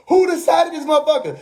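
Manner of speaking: half-laughing mock outrage; mock yell. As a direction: imitate a streamer yelling at the screen. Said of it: "yelling at the screen".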